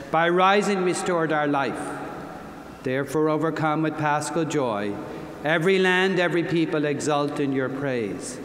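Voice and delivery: ordinary delivery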